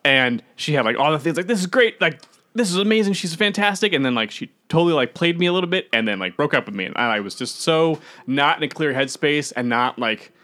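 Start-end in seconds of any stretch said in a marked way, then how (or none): 1.5-3.9 higher, eager rush of praise; gushing. Retold or imitated. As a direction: gush like someone newly smitten. Acting out infatuation with new girlfriend.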